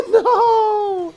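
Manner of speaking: long, high, loud wail of defeat; anguished shout. Real, not acted. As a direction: cry out in dismay, then draw out the complaint. Things go badly in game.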